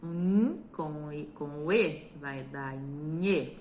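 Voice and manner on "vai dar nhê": "nhê" said slowly and softly, nasal nh then closed e; slow drawn-out nhê. Shown as a demonstration. Teacher modelling "nhê" slowly for young pupils. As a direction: stretch out "nhê" for the class. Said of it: "o som do N H é o som quando você faz assim".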